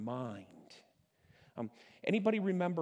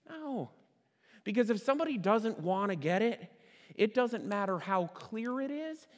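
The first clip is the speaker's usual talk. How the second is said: normal talking voice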